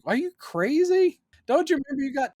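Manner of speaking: high-pitched retold disbelief; mock incredulous. Friend asks Lois how she forgot Superman, mock incredulous. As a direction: squeal it up high in mock astonishment.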